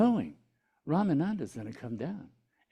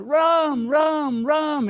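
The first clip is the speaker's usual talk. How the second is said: loud, high, drawn-out Ram calls; rooftop shouting; 12 semitones higher, 9 dB louder, much slower than usual. Retold or imitated. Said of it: "he starts going".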